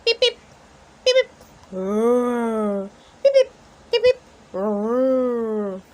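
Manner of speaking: robot dinosaur beeping and roaring. A narrator's reading. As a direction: beep like a machine, then roar. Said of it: "says a great big dinosaur".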